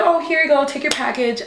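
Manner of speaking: high, singsong handover; put-on chirpy politeness, easing down in pitch; fake breezy. Seller handing over a delivery, falsely breezy.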